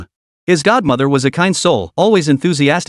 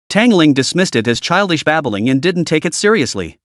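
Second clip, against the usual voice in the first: usual speaking voice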